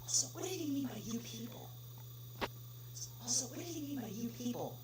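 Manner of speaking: unvoiced muttering under the breath; quiet to himself. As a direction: wonder aloud in a private whisper. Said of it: "speaks quietly to himself".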